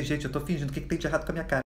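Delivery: hushed, low aside; secret thought. Acted. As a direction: mutter it softly so nobody catches you. Secret thought while faking a faint.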